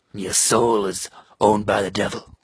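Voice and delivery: very low, slow devilish pronouncement; demonic voice. Acted. Talking to goths of the dark side, demonic voice. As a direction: drop deep and drag it out ominously.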